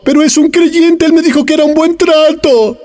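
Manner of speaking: high, mocking imitation of a fooled believer; mock gullible voice. Preacher mocking a cheated listener, high mock whine. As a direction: put on a naive whiny voice.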